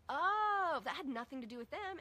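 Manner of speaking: high, loud "Oh" of discovery; a light bulb going off; sudden realization. Retold or imitated. Realizing the noise was never the kids, surprised.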